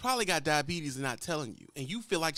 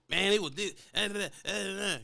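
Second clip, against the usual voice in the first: put-on young driver with attitude. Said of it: "You give 'em attitude".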